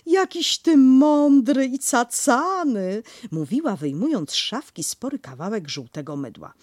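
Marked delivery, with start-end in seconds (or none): 0.1-3.0 slow, then higher and louder cooing; doting baby talk; Kanga praising baby. Acted. Kanga cooing at the baby, slow then higher.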